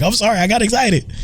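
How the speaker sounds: high-pitched imitation of a keyed-up moviegoer; mock excited apology. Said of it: "He's like".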